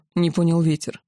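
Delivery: ordinary delivery, nothing marked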